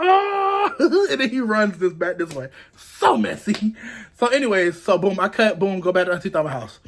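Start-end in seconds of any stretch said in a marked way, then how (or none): 0.0-1.1 high startled "Oh!"; mock caught-out cry. Tyrell caught peeking, high startled yelp. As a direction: yelp like you've been spotted. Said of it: "He was like".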